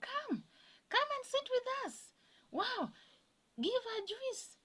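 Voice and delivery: very high, drawn-out calls of invitation; imitated eager welcome. Kenyans invite her to sit with them, high imitated eager welcome. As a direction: mimic people excitedly calling her over.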